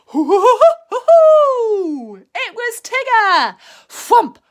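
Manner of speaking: high, loud bouncy hoo-hoo laugh; Tigger's whooping laugh. A narrator's reading. Tigger bursting in on Pooh and Piglet, loud whooping laugh. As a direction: whoop it out slow and springy.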